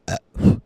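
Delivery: sudden loud burp. Real, not acted. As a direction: let out a short, loud burp. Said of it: "Two burps in one go".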